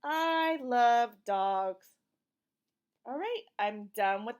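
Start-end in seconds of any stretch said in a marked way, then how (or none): 0.1-1.9 very slow and loud, each word spaced; slow read-aloud. Shown as a demonstration. A mother reading aloud, slow and loud. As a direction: read it out slowly for a toddler. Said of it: "Let's read it together".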